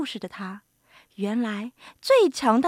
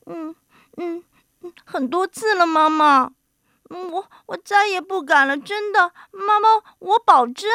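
put-on high, tearful little-boy voice; chastened giant turned crybaby; whimpering child; 6 semitones higher, 5 dB louder than usual. A narrator's reading. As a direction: whimper and plead with mother. Scolded giant whimpers promises to mother.

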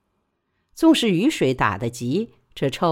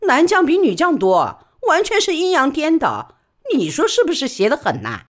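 higher, louder earthy porter woman; loud frank porter woman gossiping; He Sao's voice; 4 semitones higher, 4 dB louder than usual. Acted. He Sao voiced.

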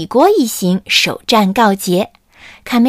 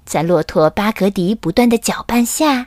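ordinary delivery

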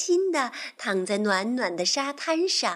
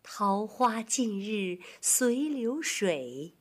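very slow, measured recital; poetry recitation; 3 dB quieter, much slower than usual. Shown as a demonstration. Teacher reciting a Tang poem for children, slow recitation. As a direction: stretch each phrase with long pauses.

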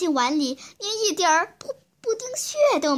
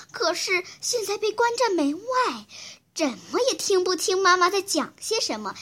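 plain reading voice

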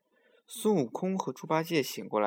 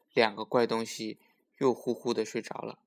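usual speaking voice

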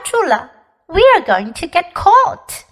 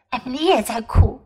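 usual speaking voice

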